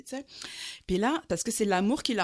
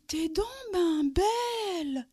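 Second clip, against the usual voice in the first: much higher, slow, wondering compliment; dazed admiring man; 12 semitones higher, much slower than usual. Retold or imitated. Calmed man suddenly compliments her.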